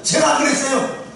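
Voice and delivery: high, mildly defensive protest; mock innocent excuse; acted denial. Eve denies and blames serpent.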